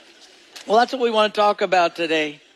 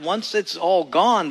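normal talking voice

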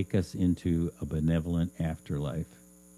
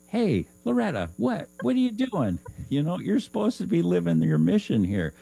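high, loud mock scolding; a spirit guide smacking sense into Loretta; 11 semitones higher, 3 dB louder than usual. Spirit guide scolding Loretta for slacking, high mock scold.

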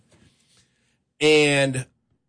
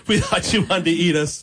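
laughing repeat; 3 semitones higher than usual. Retold or imitated. Retelling the relief to the host, laughing. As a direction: still laughing through the line.

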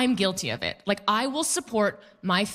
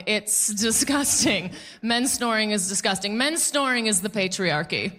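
usual speaking voice

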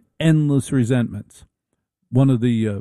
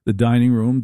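usual speaking voice

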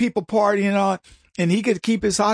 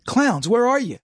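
usual speaking voice

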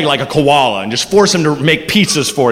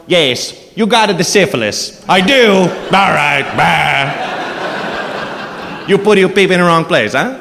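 Italian doctor voice; slower than usual. Pretend. Doctor Mario delivers test results.

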